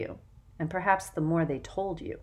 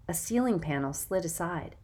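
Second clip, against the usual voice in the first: ordinary delivery, nothing marked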